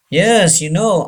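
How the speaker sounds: ordinary talk, nothing marked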